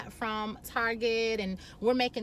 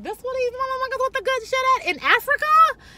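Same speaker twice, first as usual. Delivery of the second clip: high, loud, astonished squeal; much higher and louder, bowled over; amazed exclamation; 13 semitones higher, 8 dB louder than usual. Acted. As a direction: blurt it out in shocked delight. Amazed exclamation about the experience.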